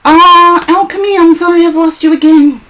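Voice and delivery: ordinary delivery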